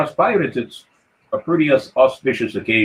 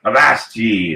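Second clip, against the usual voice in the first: pirate accent; 3 semitones lower, much slower than usual. Shown as a demonstration. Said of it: "we say".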